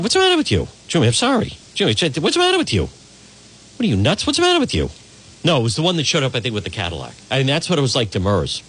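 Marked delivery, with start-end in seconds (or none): 0.0-4.9 high, put-on New York gangster; De Niro impression. Imitating De Niro scolding the guy at the bar. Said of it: "De Niro just keeps saying".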